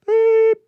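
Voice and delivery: very high, loud beep; mimicked bleep over a swear word; imitated censor beep. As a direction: sing out a piercing censor tone.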